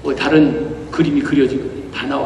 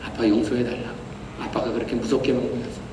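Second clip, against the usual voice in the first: low, hushed, gentle plea; almost whispering while holding him close; tender apology; 5 semitones lower, 9 dB quieter than usual. Retold or imitated. Father hugging his son and apologizing, low and hushed.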